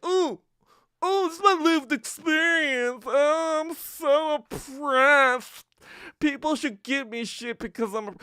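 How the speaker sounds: hammed-up oppressed whine; mocking whiny victim. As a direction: sneer it out as a self-pitying victim.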